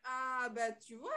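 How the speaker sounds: ordinary talk, nothing marked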